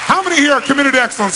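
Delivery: high, loud and quick; rallying call. Real, not acted. Speaker to audience, raising the room loudly. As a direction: fire up the crowd with the question.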